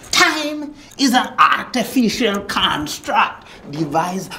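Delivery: high, emphatic proclamation; grand declaiming. Acted. Guru lecturing on time, grand declaiming. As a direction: proclaim it like a revelation.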